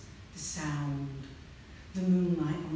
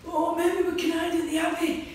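scared; 10 semitones higher, 5 dB louder than usual. Acted. Jo answers while fleeing.

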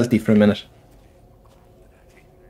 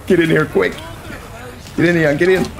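being chased; 5 semitones higher than usual. Real, not acted. Frantic while chased.

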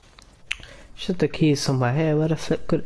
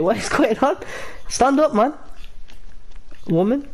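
high-pitched disbelief at the kneeling figure; shocked, much higher voice; incredulous; 10 semitones higher than usual. Real, not acted. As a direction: squeak out baffled questions. Character kneeling, shrunk view.

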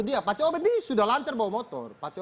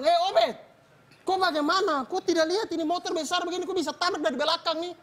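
angry scolding; 6 semitones higher than usual. Retold or imitated. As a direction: scold him from the crash. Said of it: "Pace Mingus marah".

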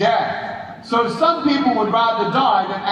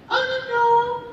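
hammed-up weepy wail; mock tearful. Imitating the crying girl after his speech, mock tearful. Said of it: "this girl started crying".

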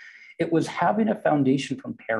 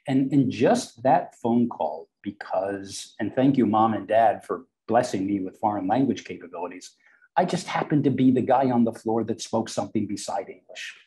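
normal talking voice

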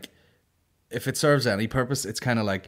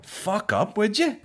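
dismissive retort; 10 semitones higher, 3 dB louder, much slower than usual. Retold or imitated. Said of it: "I was like".

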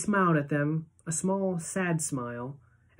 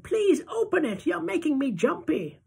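put-on very high, nervous old-lady plea; Grandma Georgina begging Charlie, high and jittery; jumpy grandmother; 13 semitones higher than usual. A narrator's reading. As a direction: fret and beg like an anxious granny.

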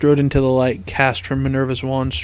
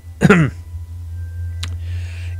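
pointed little throat-clear; preparatory ahem; 3 semitones higher than usual. A narrator's reading. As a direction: clear your throat before explaining. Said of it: "cleared his throat".

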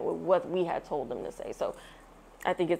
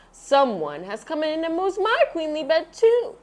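Mama Bear exclaiming; 9 semitones higher, 4 dB louder than usual. A narrator's reading. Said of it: "she exclaimed".

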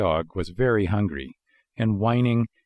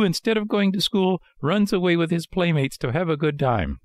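high, frightened puppet outcry; scared out of wits; 9 semitones higher than usual. A narrator's reading. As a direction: confess urgently in a high, panicked voice. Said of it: "Scared out of his wits, he cried out".

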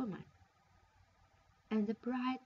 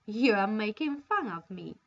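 ordinary delivery

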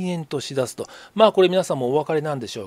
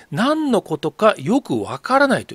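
slow, higher-pitched playing dumb; drawn-out mock bafflement; feigned puzzlement; 6 semitones higher, 4 dB louder, slower than usual. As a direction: imitate someone claiming to have no idea. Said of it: "こういう言い方してるんですよ".

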